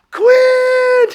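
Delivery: very high, very loud shout of delighted surprise; joyful shout. Retold or imitated. Friend recognizing him mid-class, shouting his name in delighted surprise. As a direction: yell his name in overjoyed disbelief.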